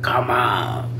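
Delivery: low, deep "Come on"; lightly mocking deep woman's voice. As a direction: drop the voice low for her line. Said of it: "'cause that voice was deep".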